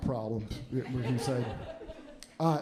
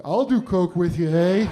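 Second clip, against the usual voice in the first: put-on Canadian accent, louder, tacked-on eh; hamming up a Canadian football player; Canadian guy; 2 semitones higher, 8 dB louder, slower than usual. Canadian stranger offering to do coke with the comedian, loud Canadian accent. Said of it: "this Canadian guy comes out like".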